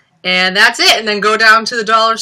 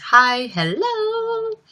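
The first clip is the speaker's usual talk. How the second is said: high drawn-out hello; much slower than usual. Acted. Reading a chat greeting.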